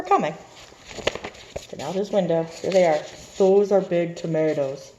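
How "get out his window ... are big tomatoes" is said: ordinary delivery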